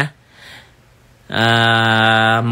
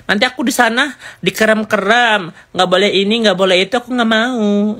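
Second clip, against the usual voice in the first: put-on much higher bratty teen girl voice, rebellious; Loli's voice; 9 semitones higher than usual. Teen Loli refuses Dokter Oki's offer, high bratty voice. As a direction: play a spoiled teenage girl refusing help, high and whiny. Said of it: "ngomongnya gitu".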